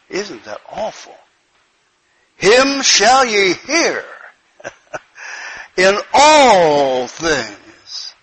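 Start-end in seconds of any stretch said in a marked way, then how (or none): 2.4-8.1 slow emphatic reading. Acted. Preacher repeats verse slowly, stressed.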